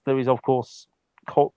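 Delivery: normal talking voice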